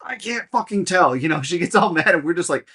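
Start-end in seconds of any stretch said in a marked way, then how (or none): 0.1-1.2 annoyed snap. Retold or imitated. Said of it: "she goes".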